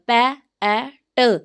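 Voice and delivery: "पै", "ए", "ट" as separate short phonics sounds; sounding out pat. Shown as a demonstration. Teacher sounding out pat letter by letter. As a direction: split pat into its sounds one by one. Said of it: "phonemes भी इसके अंदर change".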